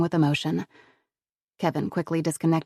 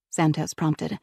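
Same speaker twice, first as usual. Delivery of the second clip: plain reading voice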